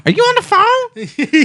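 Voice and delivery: very high, loud and slow nagging mom; shrill stepmom voice. Retold or imitated. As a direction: screech it like an annoyed stepmom. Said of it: "his stepmom would come over and was like".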